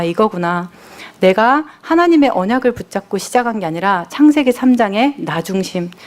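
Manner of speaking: ordinary delivery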